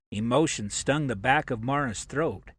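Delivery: ordinary delivery, nothing marked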